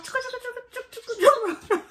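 very high laugh; squealing giggle. Real, not acted. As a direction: burst into a high-pitched cackle. Laughing after the announcement.

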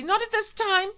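very high, loud polite refusal; shrill boy. Acted. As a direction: pipe up shrilly in alarm. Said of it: "said Paul shrilly".

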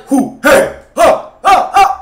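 loud, high shouted imitation; imitating shouts. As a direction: bark each word out loud. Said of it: "It does like".

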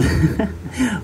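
short high giggle breaking out; high burst of laughter. Real, not acted. The reader laughs at his own line about murder songs, high giggle. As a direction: laugh despite yourself.

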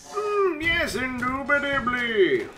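high, put-on pompous voice; mock posh gent. Player answering in a hammed-up posh voice. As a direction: say it like a snooty aristocrat.

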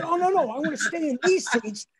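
put-on high, eager kid reply; eager insistence. Child hastily agrees with proud dad.

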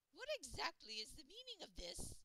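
put-on high shrill old lady voice; sharp, imperious old woman. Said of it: "old and shrill".

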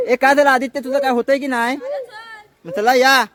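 loud, high chivvying call; shouting to hurry. Real, not acted. Hurrying the children along.

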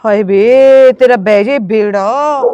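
loud, slow drawn-out curse; startled curse. Acted. Scared wife cursing her husband loudly and slowly. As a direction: wail a curse at him.

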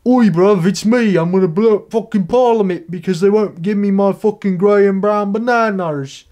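put-on loud, high Cockney British accent, oi bruv. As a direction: ham up a rough British lad. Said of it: "Oh wait, he's British".

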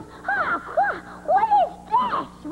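high, drawn-out dismay at a drink; appalled Elmer Fudd. Acted. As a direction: taste it and recoil, pitch shooting up. Elmer Fudd tastes a bad drink.

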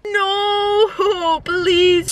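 high, drawn-out mock whine; pleading. Tired driver to friend who wants town, whiny pleading. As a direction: beg with a laugh breaking in.

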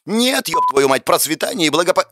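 high-pitched exasperated cursing; irate rant cut short mid-word; furious outburst. A narrator's reading. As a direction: burst out swearing, voice jumping up high.

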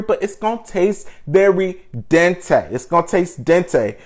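ordinary talk

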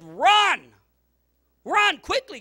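very loud, high command; full-force preacher cry; preaching shout. Acted. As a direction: roar it like a command. Quoting scripture, shouting.

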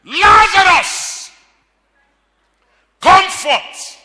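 loud, high, drawn-out cry of Lazarus; shouted call. Acted. Jesus calling dead Lazarus from the tomb, a long loud shout. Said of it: "And he shouted".

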